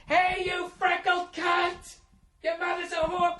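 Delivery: high, slow, screeching taunt; screamed insult. Retold or imitated. Fan screaming at a player, high screeching insult.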